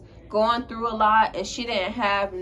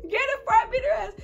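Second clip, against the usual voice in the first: heated retort; 4 dB louder than usual. Retold or imitated. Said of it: "She said".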